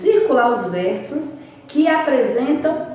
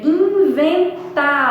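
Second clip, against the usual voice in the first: "inventado" with a drawn-out, higher TA; stress on TA; 6 semitones higher, 4 dB louder, slower than usual. Shown as a demonstration. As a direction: lean hard on the TA in "inventado". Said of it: "fala um pouquinho mais".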